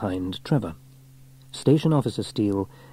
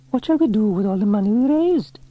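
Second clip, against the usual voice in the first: high, raised questioning voice; eager female character; 12 semitones higher, 5 dB louder than usual. A narrator's reading. Penny asking what to do with raffle money, eager. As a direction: ask it eagerly in a high pitch.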